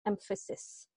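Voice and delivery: ordinary talk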